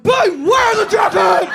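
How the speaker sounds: hammed-up loud drunken yell; bellowing demand. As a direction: barge in yelling for jackets.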